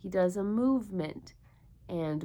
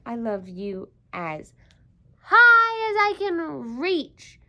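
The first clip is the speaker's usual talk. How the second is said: rising higher and louder as he stretches; little hare reaching high; 6 semitones higher, 9 dB louder, slower than usual. Acted. Little hare tells big hare he loves him as high as he can reach. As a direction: climb up with the reach. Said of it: "said Little Nut Brown Hare".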